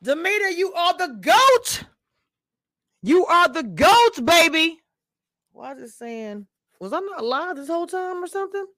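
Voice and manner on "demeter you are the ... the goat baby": excited praise, high and loud; hype shout-out. Real, not acted. Streamer hyping a guest, excited. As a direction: hype her up as the greatest.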